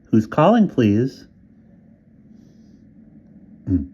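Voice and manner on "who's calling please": high, loud Southern drawl of a phone operator; Southern operator asking who is calling. A narrator's reading. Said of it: "Southern accent, nasal and whiny".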